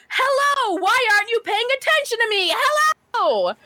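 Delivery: high-pitched mock yelling at a kid; exaggerated, high-pitched calling out. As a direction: act out pestering someone for attention, way up high. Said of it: "got down on the kid's level and yelled".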